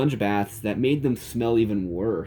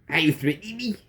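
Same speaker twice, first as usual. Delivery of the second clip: Cornholio voice; 6 semitones higher, faster than usual. A narrator's reading. Cornholio on the phone.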